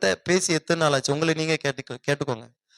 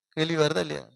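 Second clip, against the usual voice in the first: usual speaking voice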